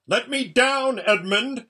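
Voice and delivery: high, loud howl; humiliated villain howling; indignant howl. A narrator's reading. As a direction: howl to be released, voice pitched right up. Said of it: "howled Rabadash".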